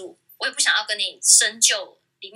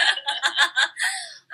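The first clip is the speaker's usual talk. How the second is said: amused laugh; 7 semitones higher than usual. Real, not acted. Laughs at ex's denial.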